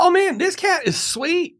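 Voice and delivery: high, slow, gushing. Real, not acted. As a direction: gush over the cat. Gushing about cat.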